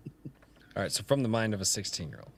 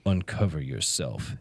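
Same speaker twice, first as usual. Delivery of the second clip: low, slow, portentous reading; super deep voice; 8 semitones lower, slower than usual. Pretend. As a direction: intone it deep and solemn. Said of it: "super deep voice".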